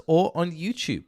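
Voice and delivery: normal talking voice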